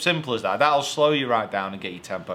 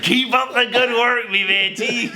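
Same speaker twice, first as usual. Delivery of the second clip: loud, high Scottish-pirate voice; botched Scottish accent gone full pirate; Scottish accent turned pirate; 11 semitones higher, 7 dB louder, slower than usual. Acted. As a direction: holler it like a bad Scottish pirate. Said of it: "I'm turning into a bloody pirate".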